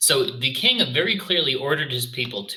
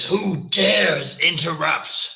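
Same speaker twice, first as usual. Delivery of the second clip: drawn-out, higher-pitched imperious demand; booming in-character challenge; very loud shout; 5 semitones higher, 3 dB louder, much slower than usual. Acted. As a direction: bellow it slowly, in character as an offended ruler. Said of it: "shouts in common in a very loud voice".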